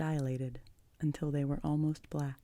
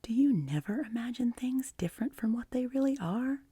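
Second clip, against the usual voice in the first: put-on high, incredulous child's question; wide-eyed wonder; 9 semitones higher than usual. A narrator's reading. Anne questions her unimaginative guardian, in wide-eyed wonder. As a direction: ask in astonishment, pitch way up. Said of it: "wide-eyed".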